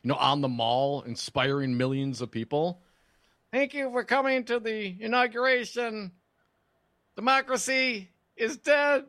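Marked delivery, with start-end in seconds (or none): 3.5-9.1 mock a grand inauguration address; Ron DeSantis voice. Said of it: "a soaring orator that is DeSantis".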